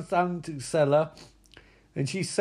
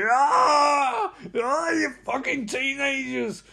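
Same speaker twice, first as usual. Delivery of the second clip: high, hammed-up howl; mock-furious screeching; enraged villain; 6 semitones higher, slower than usual. Voicing burning villain raging at teenagers.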